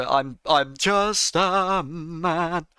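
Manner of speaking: slow, laboured emphasis on every word; drawn-out insistence. Real, not acted. Streamer pleading powerlessness to his critic, drawn-out. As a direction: stretch each word out very slowly.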